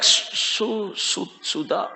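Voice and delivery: soft, broken syllables; faltering recall. A narrator's reading. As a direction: stammer out the half-remembered name quietly. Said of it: "he said something like".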